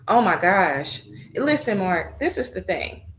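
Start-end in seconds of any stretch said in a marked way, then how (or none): none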